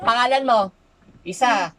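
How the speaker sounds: very loud demand; real forceful interrogation; stern ultimatum. Real, not acted. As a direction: bark the question, then a warning count. Healer demands name, starts counting.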